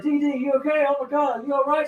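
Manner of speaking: high-pitched, panicky concern; mock alarm. Friends checking on her after the fall, mock alarmed. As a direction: imitate worried friends in a high voice.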